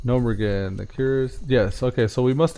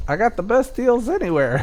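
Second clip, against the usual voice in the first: high squeaky goblin laughing; 11 semitones higher than usual. Retold or imitated. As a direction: pitch it up like a sly goblin. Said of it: "That's my goblin voice".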